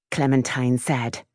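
ordinary delivery, nothing marked

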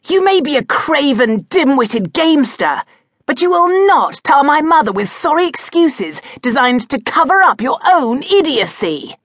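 high, loud cutting anger; control snapped. A narrator's reading. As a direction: let the contempt snap out of you. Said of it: "fragile control snapped altogether".

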